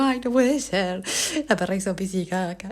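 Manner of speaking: mock-tearful complaint; imitated crying. Owner reports the dog's mess to Ezequiel, mock crying. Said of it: "yo llorando diciendo".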